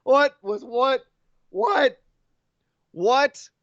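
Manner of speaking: high, repeated baffled whats; can't believe what they saw; incredulous what. Real, not acted. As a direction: keep asking what in amused disbelief. Disbelief at the wrestling spot.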